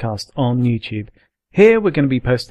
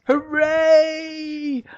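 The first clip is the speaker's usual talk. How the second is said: high squeaky cheer; hooray cheer. Pretend. Mock celebration.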